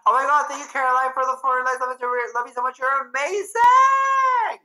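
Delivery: high, loud gushing thanks building to a shout; squealing delighted thank-you; excited thanks. Real, not acted. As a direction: gush over the gift, rising to a cheer. Thanking a viewer for lights.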